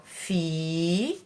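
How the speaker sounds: drawn-out syllables. Shown as a demonstration. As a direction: stretch fi, pause long, then lha. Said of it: "Duas sílabas". ("Filha" com sílabas bem esticadas)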